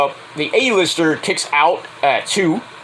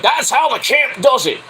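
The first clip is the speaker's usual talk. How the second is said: boastful wrestler to camera; 7 semitones higher than usual. Retold or imitated. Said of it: "looks in the camera and says".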